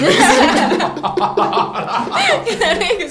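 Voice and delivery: big high burst of laughter; loud laugh. Real, not acted. Friends hear the cirrosis joke, loud high laughter. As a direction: crack up at the punchline.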